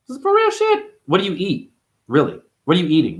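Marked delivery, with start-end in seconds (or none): 0.1-1.0 much higher squeal; mock squeal. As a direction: squeal it out in disbelief. Very high squeak.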